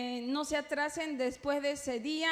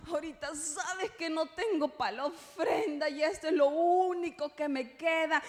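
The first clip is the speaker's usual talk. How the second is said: mock pleading; 3 semitones higher than usual. Believer excusing an empty offering to God, mock pleading. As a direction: plead with God about having no money. Acted plea to the Lord.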